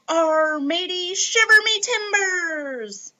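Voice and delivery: high, hearty pirate growl. Pretend. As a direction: ham it up like a pirate. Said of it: "talk like a pirate".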